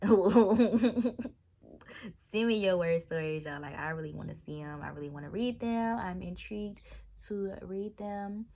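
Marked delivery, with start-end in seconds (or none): 0.0-3.4 loud laughing plea. Real, not acted. Vlogger begging viewers for stories, laughing loudly. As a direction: laugh through the request. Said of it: "laughing while asking".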